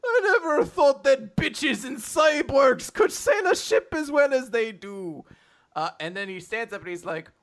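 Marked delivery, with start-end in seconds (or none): 0.1-5.2 drawn-out mock Irish astonishment; Captain Connor. Captain marvels at who sails the ship, mock Irish.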